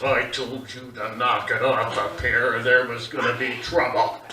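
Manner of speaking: low, stern father warning; a deep-set threat to his son; stern dad voice. Retold or imitated. Dad scolding son Dave in the bedroom, low and stern. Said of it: "My dad looks at my brother Dave and goes".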